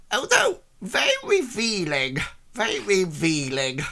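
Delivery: lightly voiced disapproving old lady; old woman. Old woman looking the hero up and down. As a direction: tut at the outfit. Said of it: "she says".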